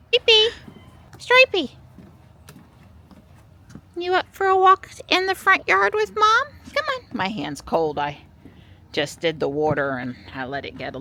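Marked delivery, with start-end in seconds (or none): 0.1-6.4 very high, loud pet-calling voice; excited pet talk. Real, not acted. Mom invites Stripey for a walk, high excited pet-talk. 6.7-7.2 very high pet-talk voice; pet coaxing. Real, not acted. Mom coaxes Stripey along on a walk, high pet-talk.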